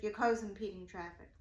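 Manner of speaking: ordinary talk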